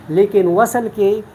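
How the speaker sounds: ordinary talk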